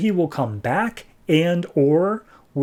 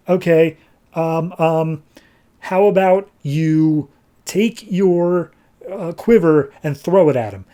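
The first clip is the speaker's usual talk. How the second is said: slow, higher, halting delivery; hesitant suggestion; 4 semitones higher, slower than usual. Acted. Henry advises Oliver under attack, hesitant and halting. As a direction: think it up as you go, word by word. Henry improvises advice over comms.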